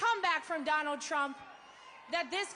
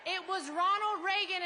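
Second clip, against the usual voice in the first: ordinary talk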